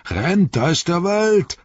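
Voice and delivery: high, loud roared command; roaring. Acted. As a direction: bellow it to get them running. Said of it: "brüllte er".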